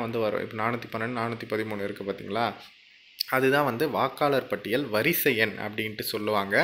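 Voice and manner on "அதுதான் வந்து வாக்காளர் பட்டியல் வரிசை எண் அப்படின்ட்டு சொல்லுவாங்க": normal talking voice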